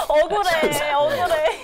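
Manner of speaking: laughing, half-crying complaint; laughing whine. Real, not acted. (웃으면서 억울한 척)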